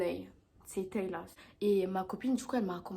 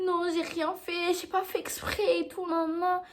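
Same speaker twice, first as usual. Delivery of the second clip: playing the victim; 8 semitones higher than usual. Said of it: "elle faisait trop la victime".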